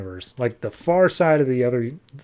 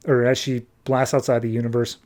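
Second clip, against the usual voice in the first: usual speaking voice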